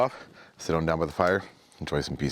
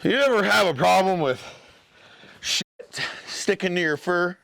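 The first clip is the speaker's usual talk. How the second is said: big bear voice; 10 semitones higher than usual. Acted. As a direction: stretch the words out big and hearty. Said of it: "And the bear goes".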